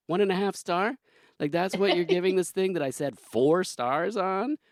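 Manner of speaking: hammed-up disbelief at a bad rating; mock outrage. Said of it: "I go on Letterboxd and I'm like".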